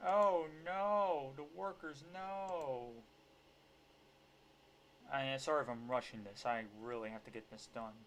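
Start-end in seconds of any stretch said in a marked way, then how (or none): 0.1-2.9 drawn-out, wailing fake dismay; mock panic. Commentator mocking a boss fretting over workers, fake panic. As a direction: whimper it in pretend horror. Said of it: "He's like going".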